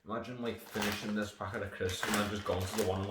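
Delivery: ordinary talk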